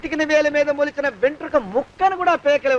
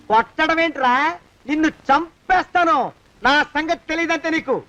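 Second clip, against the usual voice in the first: angry threat. Acted. Servant turning on his boss after a blow, angry threat. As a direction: stretch the threat out.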